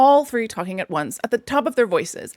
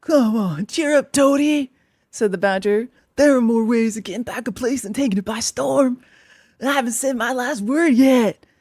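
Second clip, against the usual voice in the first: cheering Badger.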